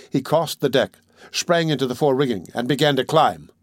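ordinary delivery

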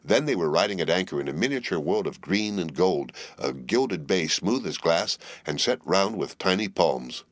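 ordinary delivery

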